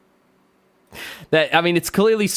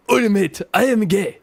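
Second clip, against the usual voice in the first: mock Japanese accent; 2 semitones higher than usual. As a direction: put on a caricatured Japanese accent. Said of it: "The Japanese talk like this".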